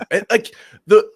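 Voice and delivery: high, loud, halting false starts; flustered stammering. Real, not acted. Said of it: "You're doing one of his".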